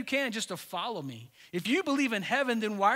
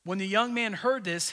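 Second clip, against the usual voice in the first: usual speaking voice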